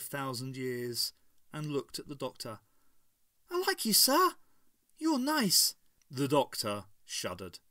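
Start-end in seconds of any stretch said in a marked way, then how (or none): 3.5-5.7 put-on high sweet child voice; little girl voice. A narrator's reading. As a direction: speak like an innocent little girl. Child Matriarch speaks sweetly.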